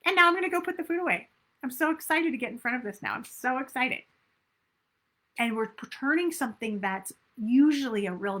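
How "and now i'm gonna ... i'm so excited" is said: high, gushing proud self-talk; excited self-praise. Shown as a demonstration. Coach models delighted self-praise instead of beating yourself up, high and gushing. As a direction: celebrate catching yourself. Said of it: "be like".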